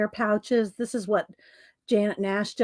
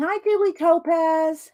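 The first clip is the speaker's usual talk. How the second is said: ordinary delivery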